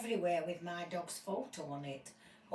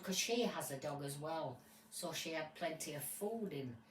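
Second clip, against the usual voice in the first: normal talking voice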